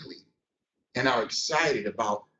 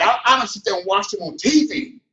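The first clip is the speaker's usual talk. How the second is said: ordinary talk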